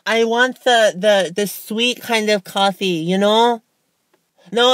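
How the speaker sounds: put-on high, bright valley girl voice; teenage girl. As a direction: play a spoiled teen at the drive-thru. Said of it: "leave you with a teenage girl impression".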